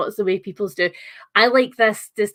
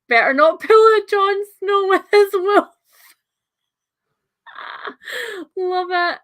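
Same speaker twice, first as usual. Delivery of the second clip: laughing outburst; 10 semitones higher, 6 dB louder than usual. Real, not acted. Reacting to a viewer's joking comparison.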